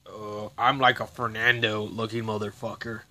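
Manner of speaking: a hint of a put-on cop voice; lightly voiced mock cop; mocking imitation. Mocking another guard with a hint of a put-on voice. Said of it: "There's another guy that's like this".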